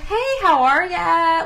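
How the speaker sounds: high, slow Southern-tinged greeting; customer service voice. Shown as a demonstration. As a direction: greet a customer in a sweet, stretched Southern lilt. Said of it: "my customer service voice goes".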